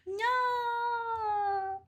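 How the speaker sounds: very high, long drawn-out no; mock no. Viewer reacting to a slang example, high drawn-out no. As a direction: stretch out a squeaky no.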